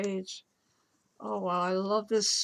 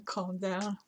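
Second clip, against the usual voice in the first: ordinary delivery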